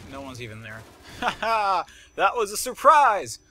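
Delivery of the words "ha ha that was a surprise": gloating. Real, not acted. Gloating after grenade kill.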